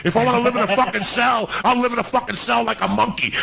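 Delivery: high, heated outburst; angry rant. Real, not acted. Ranting at minimalist houses.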